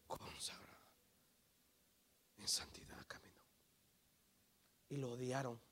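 very soft, low and spaced out; hushed solemnity. Real, not acted. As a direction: drop to a grave near-whisper. Solemn hushed answer to own question.

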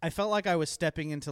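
ordinary talk

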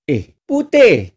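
Malay stepmother yelling for Putih; stepmother calling in Malay. Retold or imitated. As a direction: holler the name across the yard. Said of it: "if I tell in Bahasa Melayu".

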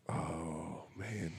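very soft, low groan to yourself; retold weary dismay. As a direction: let the words sink under your breath. Dismayed reaction to being screamed at.

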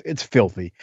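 usual speaking voice

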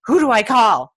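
who do I call, frantic and loud; panicked. Retold or imitated. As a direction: blurt it out in a panic. Panicking about the dog.

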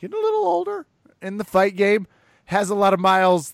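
high, loud mock of a co-host; quoting co-host. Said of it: "to quote the other person on this podcast".

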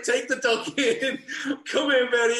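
high, loud call, half laughing at himself; shouting for the dog. Retold or imitated. Owner calling his soaked puppy indoors, high loud shout. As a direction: yell the dog in from the rain with a laugh.

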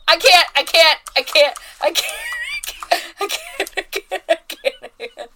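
high, loud helpless laughter; laughing hard. Real, not acted. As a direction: gasp it out through laughter. Breaks up laughing.

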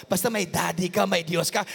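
ordinary talk

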